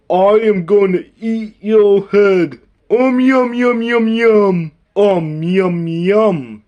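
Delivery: a playful monster threatening a bite; hungry monster. Pretend. Toy monster tells Michael it will eat his head.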